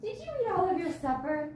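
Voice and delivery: high sweet pet voice; talking to a dog. Real, not acted. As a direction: coo at the dog.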